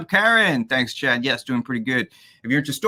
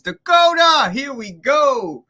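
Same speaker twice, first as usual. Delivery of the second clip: high loud hype call; excited shout; 11 semitones higher, 9 dB louder than usual. Real, not acted. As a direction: shout the name like a ring entrance. Host hypes guest Dakota.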